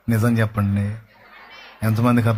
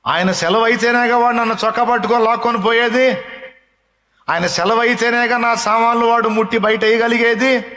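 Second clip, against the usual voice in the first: raised, high, full-force preaching voice; fiery preaching; 9 semitones higher, 3 dB louder than usual. Acted. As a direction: preach it out loud to the congregation.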